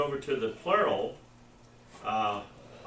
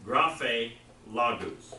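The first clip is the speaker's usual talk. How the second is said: doulos, graphe, logos read louder, each word set apart for the class; read for repeating; 7 dB louder, much slower than usual. Shown as a demonstration. Teacher reads the Greek words for the class to repeat after. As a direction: read out doulos, graphe, logos clearly so students can echo them. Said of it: "I'm gonna read it, and then you read it after me".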